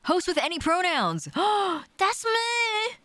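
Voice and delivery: high squeal of delight; gleeful. Acted. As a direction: squeal it like a fan. Gleeful joke about being host.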